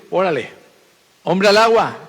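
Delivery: high call of man overboard; heave-ho call. Acted. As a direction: toss him in with a shout. Sailors throw Jonah overboard. (grito de hombre al agua, más agudo)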